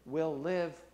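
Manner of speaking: measured preaching read of Jesus' words; scripture reading. Acted. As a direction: read the passage with gravity.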